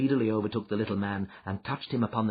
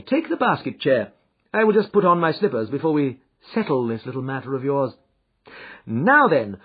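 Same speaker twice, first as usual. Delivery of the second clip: Holmes, calm and assured; 7 semitones higher, 7 dB louder than usual. Acted.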